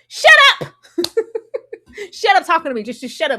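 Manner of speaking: very high loud shriek; enraged girlfriend yelling shut up; furious yell. Retold or imitated. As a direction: scream him off. Voicing Trish yelling.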